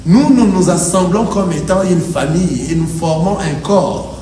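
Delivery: usual speaking voice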